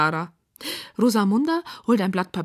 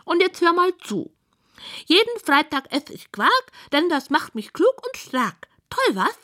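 high-voiced pig reciting verse; high, showy piggy recital; proud pig poet; 10 semitones higher than usual. A narrator's reading. Pig recites her poem proudly.

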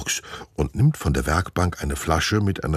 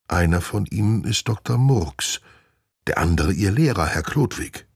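ordinary delivery

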